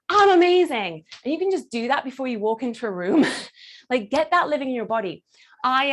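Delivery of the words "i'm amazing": raised, triumphant self-announcement; self-celebrating. Shown as a demonstration. Coach showing a power position, self-celebrating. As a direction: declare it proudly, pitched up. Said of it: "a position that makes you go".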